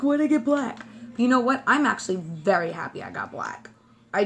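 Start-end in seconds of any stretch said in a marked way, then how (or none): 0.0-0.8 higher-pitched mock grumble; playfully whiny question; mock complaint. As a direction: imitate your own complaining, raised in pitch. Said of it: "even complained when I got it".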